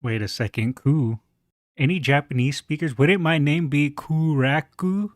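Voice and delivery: ordinary talk, nothing marked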